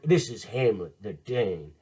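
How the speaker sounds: ordinary delivery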